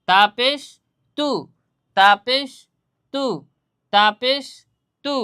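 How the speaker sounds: loud, rhythmic chant of তাপেশ তু; practice drill. Shown as a demonstration. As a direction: lead the class in repeating তাপেশ তু. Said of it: "আমরা মস্ক করি".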